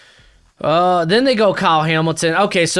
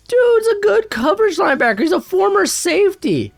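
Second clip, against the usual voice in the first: heated rant; 13 semitones higher, 3 dB louder than usual. Real, not acted. Ranting about the pick.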